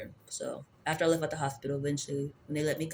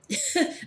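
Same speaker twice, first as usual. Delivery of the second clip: a burst of high laughter. Real, not acted. Laughing after ranting about the aunt, high and short. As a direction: laugh at your own rant.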